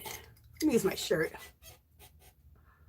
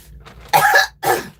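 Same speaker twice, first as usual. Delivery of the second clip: harsh unvoiced coughing burst; hoarse breathy cough; 7 dB louder than usual. Real, not acted. Still choking on water, rough breathy cough. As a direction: clear the wrong pipe with a rough cough. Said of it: "it went down the wrong hole".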